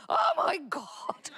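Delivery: high, panicked cry of alarm. Retold or imitated. Husband panicking as sparks fly, high panicked cry. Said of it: "And he's going".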